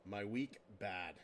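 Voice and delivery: low, subdued broken phrases, with pauses; glum caveman shorthand. Pretend. Host summing up the awful week, glum caveman-style. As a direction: drop low on each "bad".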